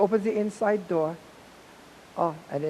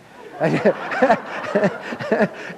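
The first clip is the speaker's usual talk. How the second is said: real laughter; hearty laugh; 4 semitones higher, 6 dB louder than usual. Real, not acted. Teller laughs at the memory of the story. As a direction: laugh out loud at it.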